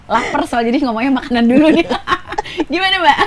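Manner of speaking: high, loud, giggly delivery; real giggles breaking into speech; amused laughing talk. Real, not acted. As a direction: laugh through the words at your own joke. Joking about ramen, laughing.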